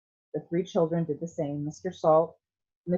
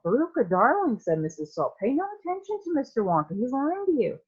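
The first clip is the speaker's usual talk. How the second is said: much higher, fast fussy mother; 9 semitones higher than usual. A narrator's reading. Mother soothing spoilt daughter about Wonka, high and fast. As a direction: coo at Veruca, high and hurried, dismissing Wonka.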